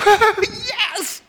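high, laughing, giddy yelp of joy; giddy yes. Retold or imitated. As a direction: burst out with a delighted squeal. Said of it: "you start laughing like".

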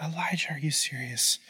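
very soft, appalled near-whisper; hushed disbelief. Acted. God listening to the prophet's blunder, hushed disbelief. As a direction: drop right down in stunned disbelief.

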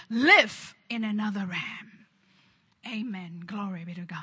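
ordinary delivery

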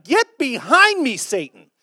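loud, high, drawn-out command; sudden fierce rebuke. Acted. Jesus turning on his disciple the next second, loud rebuke. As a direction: burst out slowly and forcefully, then drop on the last word. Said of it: "the next second, he's like".

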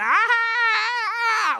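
high shrill mock toddler scream in the rain. Dad imitates his daughter screaming at the rain. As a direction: squeal like a scared toddler. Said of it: "she starts screaming".

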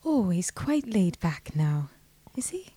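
put-on low, soft, airy Donegal accent. Guest echoing the host's singer impression, soft low Donegal accent. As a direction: murmur it in a laid-back Donegal lilt. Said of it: "what [name] sounds like".

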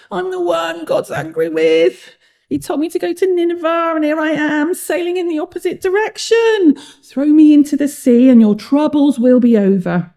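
high-pitched whining confession; groaning. A narrator's reading. Jonah tells sailors to throw him overboard, groaning. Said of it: "Jonah groaned".

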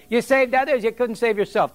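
a hint of a pipsqueak's scornful jeer; mocking taunt. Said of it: "he's spitting on me and he said".

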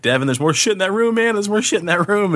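high, agitated retold warning; retold alarm. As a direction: blurt it like reporting a scare. Retold alarm after fleeing room.

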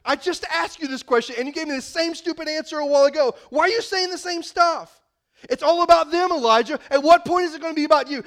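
hammed-up impatient God berating him; mock scolding. God imagined browbeating Elijah, mock exasperated scolding. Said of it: "did he beat him up going".

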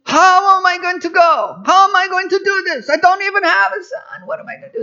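high, loud hammed-up Italian accent; over-the-top Italian accent voicing Abraham; mock Italian accent. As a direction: whine it like a comic Italian. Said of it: "That's Italian, isn't it?".

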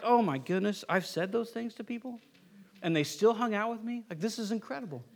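hushed, sheepish realization; mock embarrassed. Believer realizing what they said to people, mock embarrassed. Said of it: "you get all embarrassed".

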